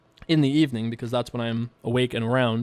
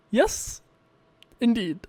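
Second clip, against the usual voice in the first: ordinary talk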